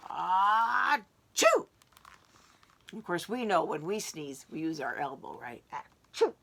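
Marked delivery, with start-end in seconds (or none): 0.1-1.7 big sneeze. Acted. Bear sneezes. 5.7-6.3 sneeze demo. Shown as a demonstration. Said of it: "This is the bear in the story".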